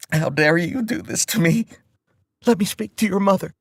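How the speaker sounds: catching her breath, still furious; anger. Acted. Mother demanding to speak to his mom, still angry. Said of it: "drowning in her anger".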